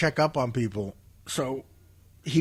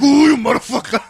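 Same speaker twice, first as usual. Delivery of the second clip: loud, high guttural curse; hammed-up guttural foreman outburst; 9 semitones higher, 10 dB louder than usual. Shown as a demonstration. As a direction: throw out a throaty, exasperated howl. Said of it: "Like guttural".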